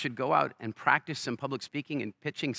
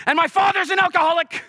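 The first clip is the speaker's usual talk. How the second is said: pointing out his father at the podium, with a loud, high, theatrical courtroom reveal; courtroom drama reveal; 11 dB louder than usual. Acted. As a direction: deliver it like a TV courtroom bombshell. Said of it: "the American courtroom drama shows".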